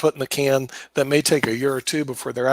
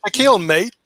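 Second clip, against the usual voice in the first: a hint of a jokey Australian accent, higher; tossing on an Australian mate, playfully; mock Australian; 5 semitones higher, 3 dB louder than usual. Teasing Shaquille in a mock Australian accent. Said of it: "good to go with the Australian".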